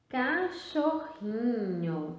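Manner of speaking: "Cachorrinho" read very slowly; full word drawn out. Shown as a demonstration. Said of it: "tá vendo?".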